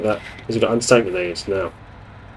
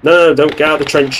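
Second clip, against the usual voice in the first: loud, high shout of no; frustrated yell; 6 semitones higher, 6 dB louder than usual. Real, not acted. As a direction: stop them, shouting. Yelling at AI soldier.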